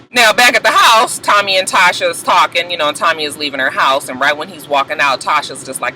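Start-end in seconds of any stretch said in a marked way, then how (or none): none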